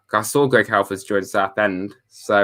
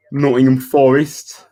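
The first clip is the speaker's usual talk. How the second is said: slow, higher, louder mock accent on "Nottingham Forest"; a hammed-up unknown accent, announcing the club; odd put-on accent; 5 semitones higher, 6 dB louder, slower than usual. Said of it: "I don't know what that accent was".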